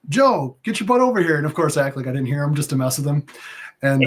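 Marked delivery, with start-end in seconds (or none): 0.1-1.4 imitating Vic calling him over, higher; mock coach summons across the mat. Vic calls Joe over to the mat, higher mock call. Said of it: "he's like".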